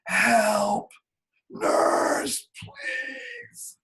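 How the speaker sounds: strained cry, high and broken; reenacting a paralyzed man calling the nurse; straining call. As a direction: push the call out with all your strength. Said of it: "I mustered all of my strength".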